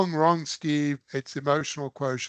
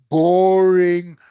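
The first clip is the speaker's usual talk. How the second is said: boring said much louder and a bit higher; punched boring; 4 semitones higher, 10 dB louder than usual. Real, not acted. Lecturer knocking the dull AI approach, hitting boring hard. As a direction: lean hard on boring to mock dull habit.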